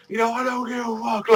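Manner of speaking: a carefree brawler laughing off the chains; defiant Natsu. Retold or imitated. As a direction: toss it off like a reckless hero charging in. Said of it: "like that, just charging through".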